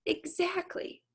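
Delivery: high, soft sing-song yes; playful daughter agreeing; cheeky. Acted. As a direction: answer sweetly sure.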